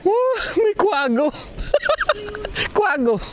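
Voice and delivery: excited. Real, not acted. Spotting an owl, laughing.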